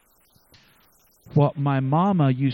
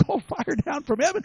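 ordinary talk

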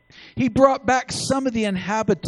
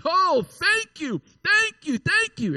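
high, gushing mock-excited thank yous; 10 semitones higher than usual. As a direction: gush like someone handed brand-new car keys. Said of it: "jump up and down and be excited and say".

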